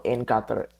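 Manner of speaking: Qatar stressed up front, like "KAH-ter"; odd pronunciation. Real, not acted. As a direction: say Qatar as "KAH-ter", weight on the first syllable. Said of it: "Why did I say it like that".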